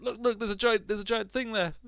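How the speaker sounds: high, frantic alarmed shout; panicked high voice. Retold or imitated. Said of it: "basically said".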